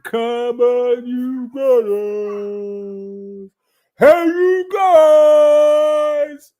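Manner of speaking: high, loud, very slow stretched shout; drawn-out call. Pretend. Vlogger mock-shouting at the chat in a long, stretched call. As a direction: call out to the guys, stretching every word.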